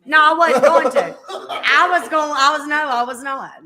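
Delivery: laughing admission. Real, not acted. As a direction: laugh out the confession. Laughing admission to teasing guest.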